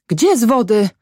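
high, alarmed question; crying out. A narrator's reading. Mother cries out at the news, high alarmed question. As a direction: exclaim in shock, without full volume. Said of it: "zakrzyczała kobieta".

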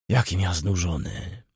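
usual speaking voice

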